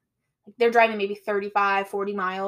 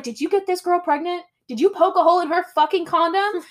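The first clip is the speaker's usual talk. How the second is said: mock angry interrogation; 7 semitones higher, 4 dB louder than usual. Old lady confronting Kevin at gunpoint.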